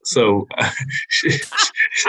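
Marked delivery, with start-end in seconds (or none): 0.6-2.1 squeaky giggling; laughing. Real, not acted. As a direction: cracks up mid-word. Laughing mid-sentence.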